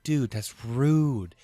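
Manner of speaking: hammed-up dude protest; mock indignant. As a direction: drag it out like you're scandalized. Retorting in retold exchange.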